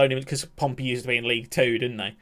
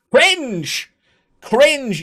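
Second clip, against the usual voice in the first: loud, high cry of disgust; disgusted shout; 12 semitones higher, 9 dB louder than usual. Real, not acted. Streamer yelling at a cringe message, disgusted. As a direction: yell it at the screen.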